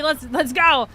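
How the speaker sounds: ordinary talk, nothing marked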